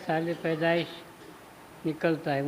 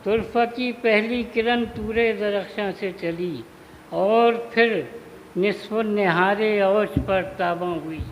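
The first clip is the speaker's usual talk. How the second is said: measured mushaira delivery, phrase by phrase; Urdu poetry recitation; 3 semitones higher, 4 dB louder than usual. Acted. Poet honouring Turfa before the gathering, measured recitation. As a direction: declaim each half-line and let it hang.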